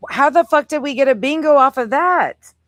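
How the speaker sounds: shouting in outraged disbelief; loud disbelief. Real, not acted. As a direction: yell it, totally incredulous. Outraged at the surprise bingo.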